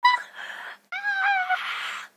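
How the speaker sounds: very high, loud wailing shriek; scream. Real, not acted. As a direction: shriek without words at full strain. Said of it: "I said I would save my voice".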